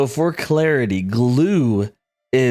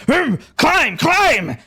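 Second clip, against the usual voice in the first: high, shouted dim-witted grunt; simple creature voice; 12 semitones higher, much slower than usual. Acted. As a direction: chant it like an eager beast. Simple creature climbing eagerly.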